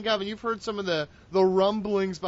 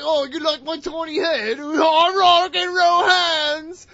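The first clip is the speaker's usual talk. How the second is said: much higher and louder, slowed, mock-Australian wrestler yell; Toni Storm; 9 semitones higher, 6 dB louder, slower than usual. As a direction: ham up a wrestler showing off her rock and roll hands. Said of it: "I was like Tony Storm".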